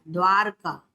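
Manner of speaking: द्वारका with a final falling tune; correct form repeated. Shown as a demonstration. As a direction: repeat द्वारका slowly, as a teacher confirming. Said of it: "द्वारका होता है".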